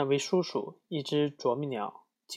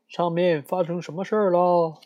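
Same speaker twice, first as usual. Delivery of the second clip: higher and louder call upward; 5 semitones higher, 5 dB louder than usual. Acted. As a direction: shout up to the antlers. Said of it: "他大声喊道". (更高更响地朝上喊)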